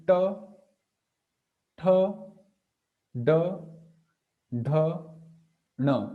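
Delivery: "ta, tha, da, dha, na" one by one, tongue curled up to the roof of the mouth; retroflex demo. Shown as a demonstration. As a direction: sound out each retroflex syllable separately: ta, tha, da, dha, na. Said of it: "the tongue is touching this point".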